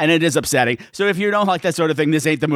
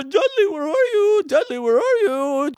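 high, plaintive mock child calling out; searching little boy; 10 semitones higher than usual. Poor Henry looking all over for his sheep, calling out high. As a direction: call like a lost little kid. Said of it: "poor little Henry's looking all over".

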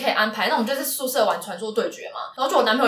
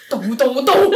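imitating angry boyfriend; 5 dB louder than usual. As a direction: act out the boyfriend's fed-up shout. Boyfriend bursts out at her. (模仿男友不耐烦地吼)

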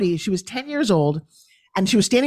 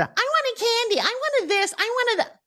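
put-on much higher, whining little kid begging for candy; whiny child; 3 dB quieter than usual. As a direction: nag like a spoiled toddler at the checkout. Kids nagging at the checkout.

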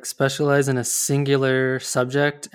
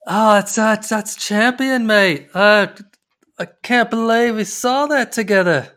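higher, louder put-on Aussie voice; attempted Australian accent; 8 semitones higher, 5 dB louder than usual. Shown as a demonstration. Host performing on demand for listeners, hammed-up Australian accent. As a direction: do an over-the-top Australian mate, pitched up. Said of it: "you should hear my Australian accent".

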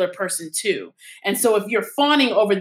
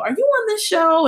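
puzzled uncle; 12 semitones higher, 3 dB louder than usual. Retold or imitated. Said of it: "he said".

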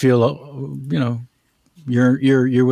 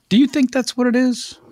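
higher probing friend; 10 semitones higher than usual. Acted.

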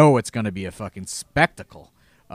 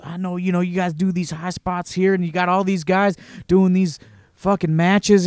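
raised worried Tracy; 6 semitones higher, 3 dB louder than usual. Retold or imitated.

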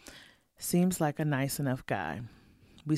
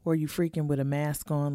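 ordinary delivery

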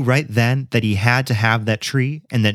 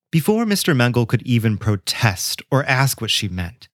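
usual speaking voice

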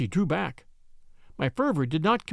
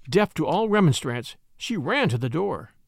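usual speaking voice

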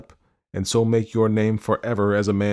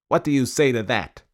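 a hint of a stern constable, higher; lightly voiced constable; 6 semitones higher, 3 dB louder than usual. A narrator's reading. Constable demands an answer from the prisoner, mildly stern.

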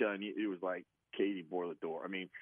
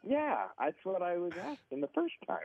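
amused retort; 9 semitones higher than usual. Retold or imitated. His retort to Tunch.

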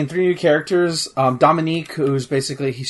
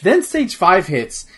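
ordinary talk, nothing marked

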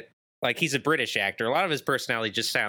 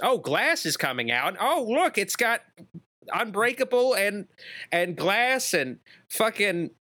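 mock excitement; 9 semitones higher than usual. Mock hype over a movie announcement.

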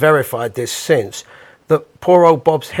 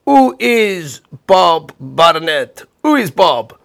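Italian host asking; 3 semitones higher, much slower than usual. Retold or imitated. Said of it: "he went right up to the band and he says".

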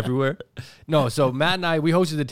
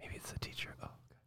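giggly prank whisper; 24 dB quieter than usual. Real, not acted. Hushed while friend on phone.

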